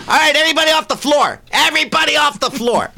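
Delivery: high, fast hammed-up cruise director shout; announcer shout. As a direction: clear the floor like a pushy host. Cruise director clearing dance floor.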